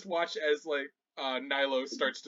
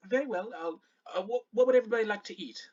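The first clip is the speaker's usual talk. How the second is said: brisk, higher server's voice; lightly voiced Shannara, obliging; 7 semitones higher, much faster than usual. Shannara with notepad taking breakfast orders, obliging. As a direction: take the order politely, a little hurried.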